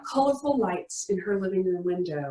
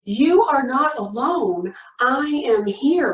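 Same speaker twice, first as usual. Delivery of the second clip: louder declaration; 3 semitones higher, 4 dB louder than usual. Shown as a demonstration. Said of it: "Say it with me".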